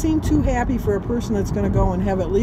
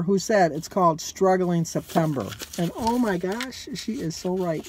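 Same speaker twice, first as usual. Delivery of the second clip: ordinary talk, nothing marked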